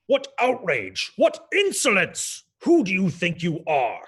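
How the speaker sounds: outraged Baron. Acted. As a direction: sputter with pompous fury.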